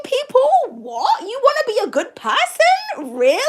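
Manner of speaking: mock-incredulous, questions leaping high; crazy people's disbelief. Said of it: "channeling what these crazy people are saying".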